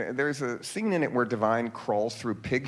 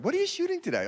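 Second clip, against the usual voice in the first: mother's suspicious question pitched way up; prying mother; faster than usual. Retold or imitated. Mother asks her son what he's filming, high and prying. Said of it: "my mother said".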